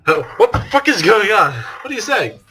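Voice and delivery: panicked shout. Acted. Hajime told he wants to eat Ibuki, yelping in panic. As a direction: yelp in alarm.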